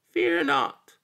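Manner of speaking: a little creature calling again in the dark; small voice. A narrator's reading. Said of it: "came a small voice in the dark".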